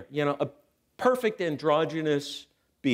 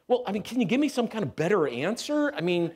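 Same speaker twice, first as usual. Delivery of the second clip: ordinary talk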